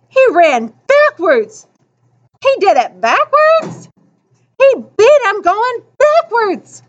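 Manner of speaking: high, amazed crowd exclaiming; wildly excited. A narrator's reading. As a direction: marvel out loud at what you just saw. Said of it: "They went crazy. They went wild".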